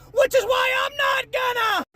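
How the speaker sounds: high-pitched exasperated yell; angry shout. Real, not acted. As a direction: burst out at full voice. Angry rebuttal.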